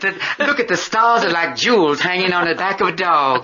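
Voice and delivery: high Tennessee Williams lady; Southern belle. Retold or imitated. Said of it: "Tennessee Williams".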